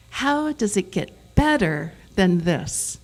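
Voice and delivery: "better" punched out on its own; slow sentence leaning on "better"; stress on better. Shown as a demonstration. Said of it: "where you emphasize each word in a sentence".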